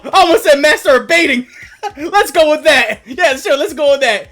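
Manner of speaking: giggly, cracked-up delivery; laughing. Real, not acted. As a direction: laugh out the words. Laughing at own joke.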